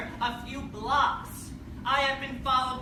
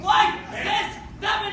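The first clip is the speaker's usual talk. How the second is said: angry shout; 7 semitones higher, 5 dB louder, slower than usual. Real, not acted. Heated protest confrontation, shouting.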